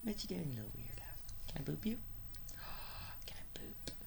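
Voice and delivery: soft whispered pet talk; whispering to a pet. Real, not acted. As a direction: hush to the little dog. Whispering to the dog.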